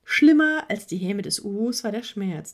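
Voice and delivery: plain reading voice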